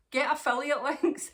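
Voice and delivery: giggling as you say it again; laughing repeat. Real, not acted. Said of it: "repeats it laughing".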